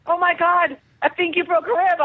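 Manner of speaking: high, alarmed, pretend panic; mock panicked director. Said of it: "he was like".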